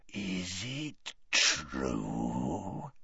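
very slow, low, hushed menace; deadly voice. A narrator's reading. Gaunt confronts his terrified daughter, deadly. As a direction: draw out each word, deadly soft. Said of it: "said Gaunt in a deadly voice".

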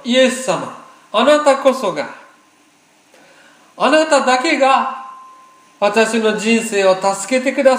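high, emphatic proclamation. Shown as a demonstration. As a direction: lead the congregation in declaring it, voice lifted. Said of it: "大きな声で言いましょう".

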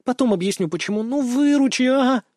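put-on high, coaxing girl's voice; pleading. A narrator's reading. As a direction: beg a friend to help out. Girl begs her friend for help.

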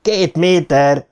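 drawn-out, slow mock yell; mild yell. A narrator's reading. Boy howls out a distance to his friend, mild yell. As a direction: stretch it out in a restrained howl. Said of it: "üvöltötte Harry".

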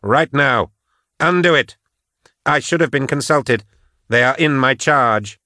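high, forceful insistence; heated, urgent order; angry demand. Acted. Patience demands reversal.

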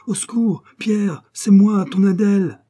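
high, loud, drawn-out call for help; woman crying out. Acted. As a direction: cry out like a woman in danger, stretching the words. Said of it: "une voix de femme qui crie".

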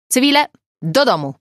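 loud, high, quick order to civilians; calling out. A narrator's reading. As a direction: call out sharply to send the group home. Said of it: "zawołał Garstka". (głośne, energiczne zawołanie)

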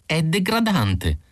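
high, slow, drawn-out protest; complaining. A narrator's reading. Isidor protesting about the whisky story, complaining. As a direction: stretch out the indignant complaint. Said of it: "si lamentò Isidor".

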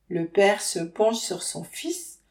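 ordinary delivery, nothing marked

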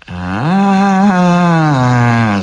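long, ominous drawn-out witch sound; sinister drawl. A narrator's reading. Baba Yaga sees through Ivan, sinister drawl. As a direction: stretch it out with menace. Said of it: "зловеще протянула Баба Яга".

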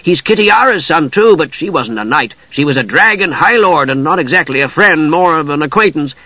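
high-pitched eager kender voice; enthusiastic. A narrator's reading. Tass introducing his friends to Usha, high and enthusiastic. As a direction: make keen introductions in a high voice. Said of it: "said Tass enthusiastically".

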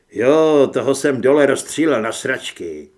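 very loud retort; brash yell at normal pitch; taunting hero. A narrator's reading. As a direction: shout it back to goad her.

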